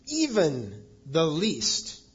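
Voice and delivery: EVEN and LEAST each hit hard, slowly; emphatic. Retold or imitated. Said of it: "And then he's emphatic".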